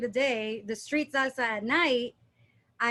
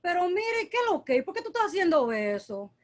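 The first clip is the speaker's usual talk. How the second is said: higher, fretful Dominican Spanish; Dominican accent; 5 semitones higher than usual. Retold or imitated. Dancer showing the host a worried Dominican accent. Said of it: "it kinda sounds like".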